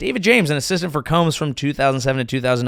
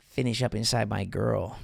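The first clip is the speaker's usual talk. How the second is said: low muttered order; 4 semitones lower, 9 dB quieter than usual. Retold or imitated. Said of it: "he would say".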